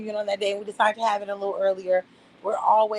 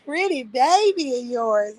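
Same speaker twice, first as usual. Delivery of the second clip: cooing neighbours; 3 semitones higher, 5 dB louder, slower than usual. Retold or imitated. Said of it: "everyone is like".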